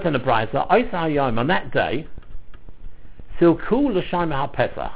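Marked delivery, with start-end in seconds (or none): none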